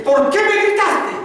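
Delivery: high, slow, full-volume shout; acted furious confrontation; angry father. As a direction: roar the accusation at a son, spacing it out. Acted father confronting his son.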